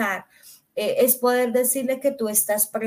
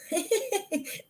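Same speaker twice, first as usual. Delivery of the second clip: burst of laughter; 9 semitones higher, 8 dB quieter than usual. Real, not acted. Laughs at her own answer.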